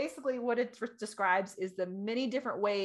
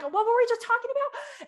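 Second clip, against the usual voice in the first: high, loud exasperation; mock-exasperated outburst, pitch jumping up; annoyed husband; 13 semitones higher, 6 dB louder than usual. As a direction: imitate a husband who lost his train of thought. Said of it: "he gets so annoyed with me".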